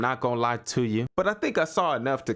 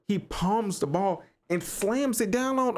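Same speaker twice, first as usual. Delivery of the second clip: ordinary talk, nothing marked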